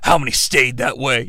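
low, soft brooding hero voice; mock-serious low rasp; gravelly Batman-style Jesus. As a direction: growl it like a grim superhero. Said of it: "Jesus is like".

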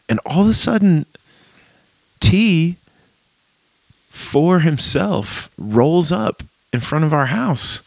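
high, slow building excitement; excited. Retold or imitated. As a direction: stretch it out with glee. Excited retelling of surprise visit.

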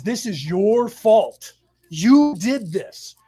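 loud, slow accusation, each word weighted; retold angry yelling. Stepfather's friend scolds roommate on the phone, retold angry yelling. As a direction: imitate a man laying blame down the phone. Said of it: "He's yelling at her on the phone".

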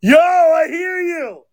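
high, drawn-out shout of discovery; excited shout. Real, not acted. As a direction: holler it high and long. Finally hears co-host on call.

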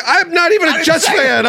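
high, loud laughing protest; mock pleading. Scared fan pleads with the linebacker, high and laughing. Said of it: "I'm like".

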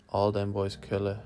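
ordinary delivery

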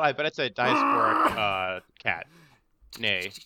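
usual speaking voice

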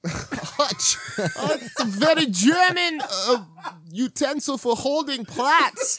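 hammed-up German accent, high and drawn out; mock German accent. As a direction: play a pompous German explaining a word, slowly. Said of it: "terrible German".

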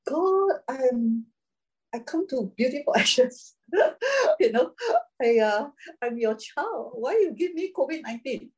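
high whiny plea to God, nearly crying; complaining to God. Retold or imitated. Bernice pleading to God over covid, high and teary. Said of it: "Bernice could have said that".

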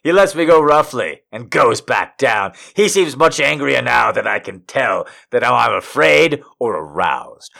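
usual speaking voice

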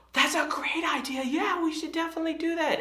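put-on high-pitched gushing little-kid excitement; excited daughter. Daughter thrilled at dad's pizza idea, mock excited squeal. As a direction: imitate a thrilled child, voice way up. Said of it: "she was just so excited".

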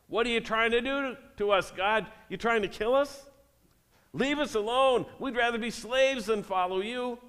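high, whiny mock complaint to God; whining complaint. Congregants whining at God on the beach, hammed-up high whine. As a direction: whine like a scared ex-slave blaming God. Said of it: "you quickly start whining and blaming God".

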